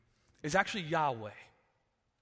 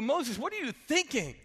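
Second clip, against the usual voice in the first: exasperated; 8 semitones higher than usual. Retold or imitated. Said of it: "to, to go".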